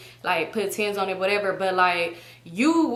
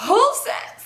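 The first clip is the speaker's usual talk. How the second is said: shocked; 13 semitones higher, 4 dB louder than usual. Retold or imitated. Shocked reply in the argument.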